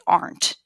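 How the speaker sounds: "Aren't" said as one tight syllable; squished aren't. Shown as a demonstration. Teacher shows "Aren't" squished together. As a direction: squash "Aren't" into a single beat. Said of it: "squish those together and say".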